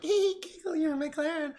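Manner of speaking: high, giggly mocking girl voice. Mocking the woman echoing his words, high giggly girl voice. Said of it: "And she's like".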